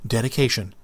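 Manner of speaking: usual speaking voice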